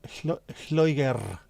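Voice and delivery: false start, then "Lloegr" with a long rolled R; "Lloegr" ending in a big cat's growl; growled pronunciation. Shown as a demonstration. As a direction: drag out the rolling end of "Lloegr". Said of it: "like a lion and a tiger stuck together".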